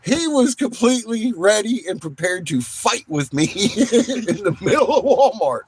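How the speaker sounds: laughing through the words; words breaking into laughter. Real, not acted. Telling a friend about the bald cop, laughing.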